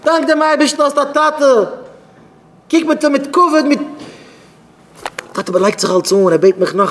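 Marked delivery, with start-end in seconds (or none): 0.0-1.8 high, slow, stretched-out cry; acted outrage, pitched far up; indignant rebuke. 2.7-3.8 high-pitched indignant scolding; acted rebuke.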